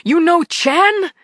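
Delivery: high loud demanding shout; accented shout. A narrator's reading. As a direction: bark it, slow and loud. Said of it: "he was shouting".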